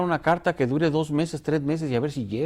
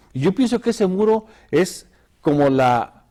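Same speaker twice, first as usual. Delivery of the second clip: ordinary talk, nothing marked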